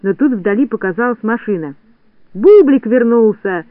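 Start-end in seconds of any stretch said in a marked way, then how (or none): 2.3-3.6 high, loud, drawn-out happy cry; joyous crowd cheer, slow and loud; joyful shout. A narrator's reading. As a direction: cry it out in delight. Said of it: "закричали все радостно".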